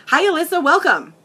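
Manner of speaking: high, bright hello to a newcomer; delighted high greeting; excited welcome. Real, not acted. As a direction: greet a viewer who just joined, high-pitched. Greeting a viewer joining live.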